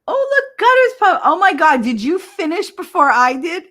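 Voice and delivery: high, loud excited surprise; surprised. Real, not acted. Streamer surprised that a viewer finished first, high and loud. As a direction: squeal it out, caught off guard.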